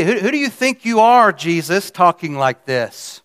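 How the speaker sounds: acted-out affronted questioner; indignant challenge. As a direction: challenge him, who does he think he is. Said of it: "they'd asked him up in verse fifty-three".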